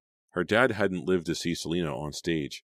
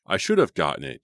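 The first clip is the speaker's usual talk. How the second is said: indignant, a little louder; heated exclamation; 6 dB louder than usual. A narrator's reading. Boy passed over for Romeo, heated exclamation. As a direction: protest with a flash of heat. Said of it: "Jake exclaimed with surprising heat".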